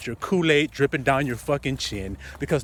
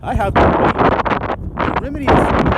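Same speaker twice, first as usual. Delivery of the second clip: fiery preaching; 3 semitones higher, 6 dB louder than usual. Acted. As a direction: lean in and preach it hard. Preaching at full force.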